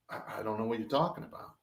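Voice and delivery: quiet, fast, sheepish denial; timid denial. Retold or imitated. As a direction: mumble it nervously. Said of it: "I'm like".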